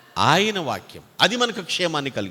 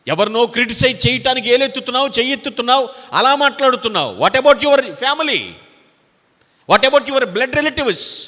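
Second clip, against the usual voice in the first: rebuking shout; 7 semitones higher, 6 dB louder than usual. Acted. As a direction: confront the critic head-on. Preacher rebuking critics. (గద్దిస్తూ గట్టిగా నెమ్మదిగా)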